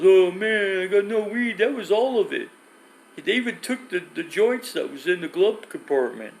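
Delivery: high-pitched, wailing complaint; mock whining friend. Friend Jim lamenting to the driver after the bust, high mock whine. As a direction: imitate a buddy moaning over a loss.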